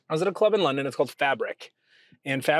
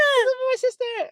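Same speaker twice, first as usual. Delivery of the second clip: put-on very high, squeaky British accent; hammed-up high-pitched British voice; British girl's accent. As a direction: imitate a British club girl, pitched way up. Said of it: "That's exactly how she sounded".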